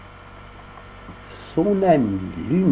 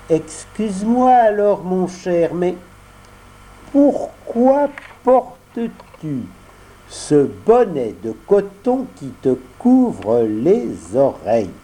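put-on higher, slow probing puppet boy; voix de pantin; 7 semitones higher, slower than usual. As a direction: puppet boy prying gently. Pinocchio questionne. (voix de pantin curieuse)

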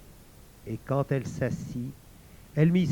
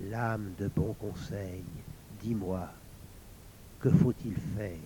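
softly murmured plea, a little shaky; plain murmured question; trembling murmur; 5 semitones lower, 6 dB quieter than usual. A narrator's reading. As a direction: ask the sword in a hushed, trembling voice. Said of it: "murmura tremblante".

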